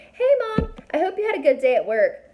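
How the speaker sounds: sing-song high, loud and fast fake-innocent greeting; sarcastically sweet, as if telling Mom nothing happened; mock cheery greeting. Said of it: "mock cheerful report to Mom".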